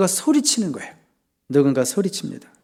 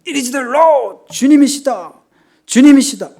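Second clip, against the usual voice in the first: high, loud exclamations of recognition; ringing full-voiced yell; excited shout; 10 semitones higher, 10 dB louder, slower than usual. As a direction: cry it out to the whole boat. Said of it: "막 소리 지른 거죠".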